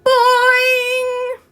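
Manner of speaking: high, loud sung-out boing; long drawn-out boing. Acted. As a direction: stretch the boing long like a singing spring. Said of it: "uttered a long, drawn-out".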